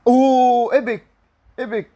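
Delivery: sudden high yelp of delight; real excitement at an epic card; excited shout. Real, not acted. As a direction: shout it out, thrilled by the pull. Pack opening reveals epic player.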